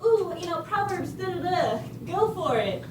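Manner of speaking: mocking shallow advice given to hurting people. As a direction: parody a breezy preachy cheerleader. This world does not need.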